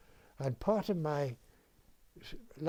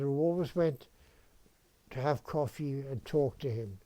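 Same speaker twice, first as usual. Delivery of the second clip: ordinary talk, nothing marked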